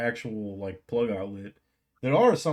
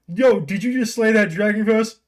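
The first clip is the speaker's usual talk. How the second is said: excited gamer; 9 semitones higher, 7 dB louder than usual. Pretend.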